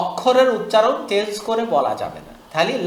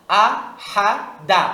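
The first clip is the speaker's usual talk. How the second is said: slow syllable-by-syllable; much slower than usual. Shown as a demonstration. Teacher spells slowly, then joins.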